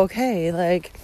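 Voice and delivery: normal talking voice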